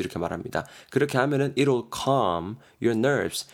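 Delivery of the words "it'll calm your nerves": 아주 느리게 한 단어씩 또박또박 읽기; 학생들이 따라오게 천천히 읽어주기. Shown as a demonstration. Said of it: "예문 천천히 다시". (예문을 아주 느리게 또박또박)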